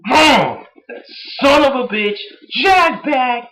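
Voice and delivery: high-pitched, exasperated name-calling; hammed-up cursing. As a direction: curse him out in high, separate bursts. Cursing the hated commentator.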